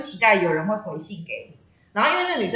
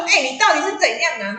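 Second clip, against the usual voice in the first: mock exasperated; 5 semitones higher, 5 dB louder than usual. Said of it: "然后我冲出去说".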